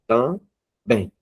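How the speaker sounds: "Também" split as tam, bem; syllable by syllable. Shown as a demonstration. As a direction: pause between TAM and BEM. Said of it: "o bem é mais forte".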